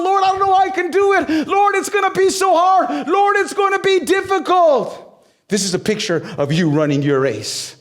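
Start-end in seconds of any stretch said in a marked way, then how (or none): 0.0-5.0 anguished crying out. Pretend. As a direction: cry out to God in struggle. Voices an anguished prayer.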